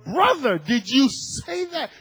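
loud, high, incredulous question; mock-shocked outcry to a friend; acted shock. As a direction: stretch it out in scandalized disbelief. Acts out his shocked reply to pastor.

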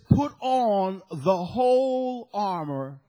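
whole drawn out slowly; stress on whole. Real, not acted. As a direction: lean hard on the word whole. Preacher quoting scripture slowly.

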